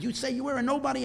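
high, quick, indignant mock yell; acted high-pitched challenge; retold scream. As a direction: throw it back in mock outrage. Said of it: "and the guy screams".

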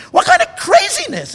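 high, loud cry of outrage; outraged shout. Real, not acted. Preacher shouting his outrage at fear-mongering rabbis. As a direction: explode in disbelief at the rabbis.

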